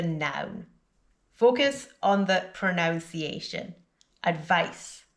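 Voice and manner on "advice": Advice ending in a hissed S; final S sound. Shown as a demonstration. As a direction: say "Advice" with an unvoiced S at the end. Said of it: "with the S sound at the end".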